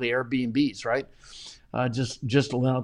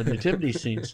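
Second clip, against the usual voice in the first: ordinary talk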